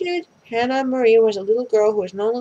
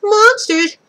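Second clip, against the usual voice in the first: excited character voice; 10 semitones higher, 7 dB louder than usual. Acted. Character voice in read story.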